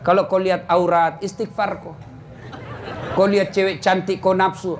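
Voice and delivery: ordinary talk, nothing marked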